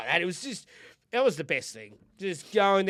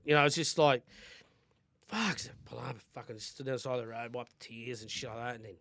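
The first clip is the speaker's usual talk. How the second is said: ordinary talk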